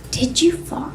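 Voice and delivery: soft, giggling aside to her husband; hushed aside. Retold or imitated. Wife quietly asks her husband at the table, hushed and giggling. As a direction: sneak the question under your breath.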